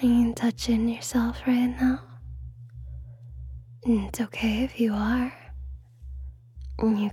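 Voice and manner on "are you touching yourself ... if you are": intimate ASMR whisper; breathy hypnotic whisper close to the mic. Acted.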